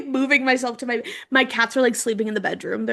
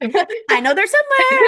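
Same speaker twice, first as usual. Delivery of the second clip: higher, louder playful sing-song; sing-song repeat; 10 semitones higher, 5 dB louder than usual. Real, not acted. Repeats the line for fun.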